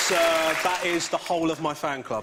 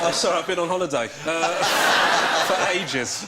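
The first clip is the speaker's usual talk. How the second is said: laughing mock excuse; 6 dB louder than usual. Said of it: "at the interview".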